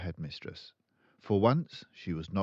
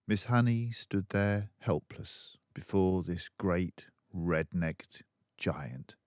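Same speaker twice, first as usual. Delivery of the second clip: ordinary delivery, nothing marked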